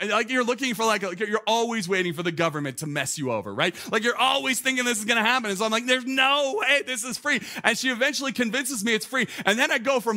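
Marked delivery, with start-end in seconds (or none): 5.8-7.4 suspicious disbelief. Retold or imitated. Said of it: "suspicious mode".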